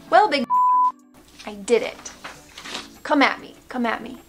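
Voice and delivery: normal talking voice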